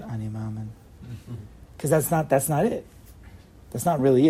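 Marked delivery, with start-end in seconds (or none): 0.0-0.7 unconvinced, just reciting the words; droopy, half-hearted. Shown as a demonstration. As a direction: say it without conviction. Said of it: "Don't say it".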